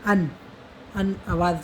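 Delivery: अन said as a clear nasal an sound; teacher sounding out अन; sound demo. Shown as a demonstration. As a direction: hold the nasal in अन. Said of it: "आवाज़ इसकी sound यही रहती है".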